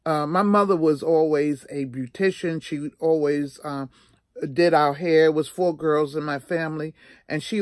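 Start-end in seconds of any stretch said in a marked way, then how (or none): none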